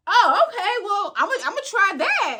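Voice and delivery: high-pitched, lively imitation of an eavesdropper; interested bystander. Guy at the bar overhearing her drink tip, high interested voice. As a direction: pipe up high, taken with the idea.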